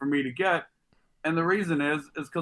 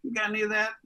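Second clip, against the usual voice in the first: higher, jittery street hustler voice; strung-out crackhead; 6 semitones higher than usual. Retold or imitated. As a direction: beg like a twitchy crackhead. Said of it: "looked like that crackhead on the Chappelle Show".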